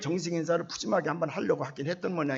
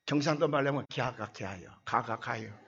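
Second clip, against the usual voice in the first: normal talking voice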